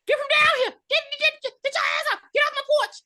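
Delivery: very high loud mock yelling; shrill old woman yell. Imitating the woman chasing them off, high screeching yell. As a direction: screech it like an angry granny on her porch. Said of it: "she's like".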